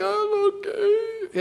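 high, thin mock-tearful squeak; about to fall apart. Hurting churchgoer greeted before service, pretending to cope, near tears. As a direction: imitate someone barely holding it together, slow and high. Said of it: "ready to fall apart".

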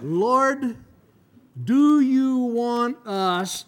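high, slow eager plea; eager disciples. Acted. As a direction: draw it out eagerly, pitched high. Disciples eagerly asking Jesus.

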